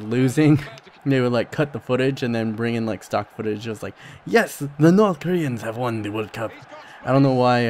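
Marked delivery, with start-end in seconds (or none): 4.2-6.5 a light mock Spanish accent, half-joking. Faking a North Korean broadcast announcing a World Cup win, light Spanish accent. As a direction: toss the line off in a hammed-up Spanish accent. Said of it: "I gave that North Korean a, a Spanish accent".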